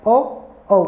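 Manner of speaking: drawn-out ओ sounds; ओ sounded between two vowels; ओ demonstrated slowly; vowel demo. Shown as a demonstration. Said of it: "इनके मध्य में होता है ओ".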